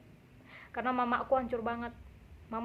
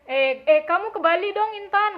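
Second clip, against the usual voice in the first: higher, louder wheedling invitation; coaxing boyfriend; 6 semitones higher, 8 dB louder than usual. Retold or imitated. Rich boyfriend urging his girlfriend to come to Bali, higher and louder wheedling. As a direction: coax her into the trip like a pushy suitor.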